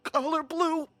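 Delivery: high, faint melodramatic last wishes; hammed-up deathbed plea; dying-words kid. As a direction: gasp out a final request theatrically. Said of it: "and I'm gonna say".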